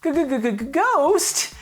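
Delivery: high, stammering scared cartoon yelp; Scooby-Doo stutter. Reviewer echoing the show's scared kid, Scooby-Doo stammer. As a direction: stutter the g like a spooked cartoon dog. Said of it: "We've gone full Scooby-Doo!".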